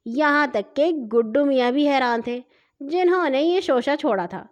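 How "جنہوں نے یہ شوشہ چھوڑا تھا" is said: ordinary delivery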